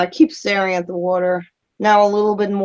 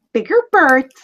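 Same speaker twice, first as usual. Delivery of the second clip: higher-pitched mocking echo; mock the bird with a pitched-up parroting; exaggerated mockery; 5 semitones higher, 3 dB louder than usual. Player taunting the raven a second time, exaggerated mockery. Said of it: "Are you mocking me?".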